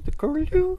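high piping curlew cry; curlew bird call. Shown as a demonstration. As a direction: sing it like the bird. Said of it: "named after their call".

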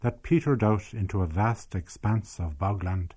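measured storytelling read; story narration. A narrator's reading. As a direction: tell the village tale evenly, like a documentary. Documentary narration of memoir.

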